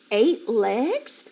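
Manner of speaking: usual speaking voice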